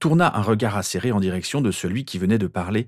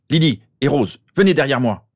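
loud, higher urgent command; aufgeregter Ausruf; 6 semitones higher, 6 dB louder than usual. A narrator's reading. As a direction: cry out an order to get behind you. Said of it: "il s'écria".

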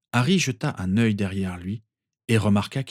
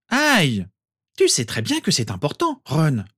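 Hermione scolding; 7 semitones higher, 3 dB louder than usual. A narrator's reading.

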